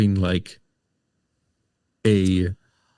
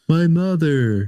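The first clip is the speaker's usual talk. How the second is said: mocking the kid's line; 7 semitones higher than usual. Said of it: "how he said it".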